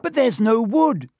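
high, loud wail; panicked cry. A narrator's reading. Hermione wringing her hands, panicked cry. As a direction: cry out helplessly, pitch way up. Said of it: "Hermione cried".

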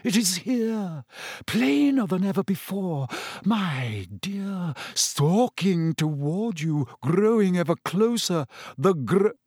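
high, slow, drawn-out mystic foreboding; a dreamy prophetess predicting doom; ominous seer. Acted.

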